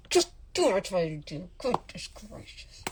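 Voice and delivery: low, hushed mock exasperation; muttered scolding. Telling customers to just obey, muttered scolding. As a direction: drop to a near-whisper to scold.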